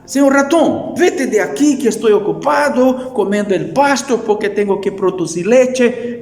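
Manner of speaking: a cow too busy eating to care; indifferent cow. Acted. Preacher as the cow shooing the mouse off, high and loud. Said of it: "Y la vaca dice así".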